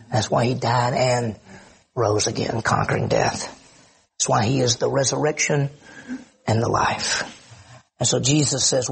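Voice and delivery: normal talking voice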